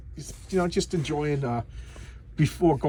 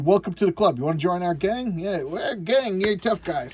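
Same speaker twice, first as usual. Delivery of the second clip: lightly hammed-up, higher-pitched welcome; welcoming gang member; 4 semitones higher, 3 dB louder than usual. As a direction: imitate a gang taking in a recruit.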